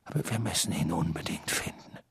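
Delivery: very soft, low, near-whisper; barely voiced and low; hushed urgency. A narrator's reading. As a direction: whisper it gravely to a colleague. Dumbledore confers softly with Moody.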